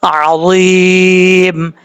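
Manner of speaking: tajweed demonstration; heavy za. Shown as a demonstration. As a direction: stretch अज़ीम with a heavy ज़. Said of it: "इस तरीके से".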